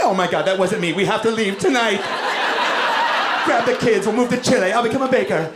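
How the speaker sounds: high frantic voice; panicked woman. Retold or imitated. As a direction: flee the shame in a rush. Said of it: "They're just like".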